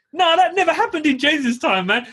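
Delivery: high, scoffing disbelief; incredulous. Retold or imitated. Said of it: "I'm thinking".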